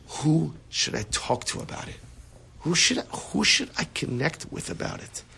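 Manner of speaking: soft, searching question; hushed self-questioning. Acted. Upset person asking himself whom to talk to, hushed. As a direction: ask yourself softly, with room to wonder.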